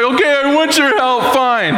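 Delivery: high-pitched grudging give-in, imitating a child; mock exasperation. Daughter giving in to parents' homework help, exasperated. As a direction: act out a daughter giving in under protest.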